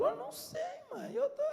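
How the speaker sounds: very high, laughing "não sei"; playing dumb. Son fending off his mother, squeaky and laughing. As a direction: squeak it out like a sheepish kid.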